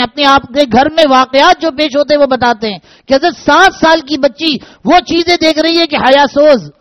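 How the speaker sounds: normal talking voice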